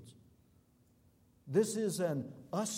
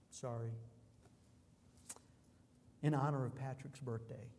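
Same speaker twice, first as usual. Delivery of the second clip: ordinary talk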